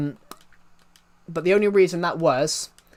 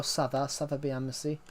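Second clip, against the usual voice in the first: quiet French; 7 dB quieter than usual. Shown as a demonstration. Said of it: "it's bonjour".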